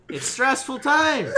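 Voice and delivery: loud, high, drawn-out through a laugh; laughing protest. Real, not acted. Host defending the solemn sign-off amid giggles, laughing. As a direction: stretch it out over laughter.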